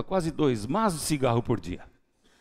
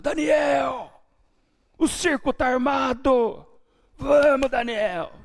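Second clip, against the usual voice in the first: loud call from the gate, higher pitched; friends shouting; 8 semitones higher, 3 dB louder, slower than usual. Retold or imitated. Friends calling Daniel out from the gate, shouting. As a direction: holler his name from the street like a mate. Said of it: "E lá no portão eles gritavam".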